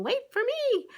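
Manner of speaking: high, loud call after her friends; calling after friends who keep walking, high and loud. Retold or imitated. To her friends walking ahead, a high loud call to wait.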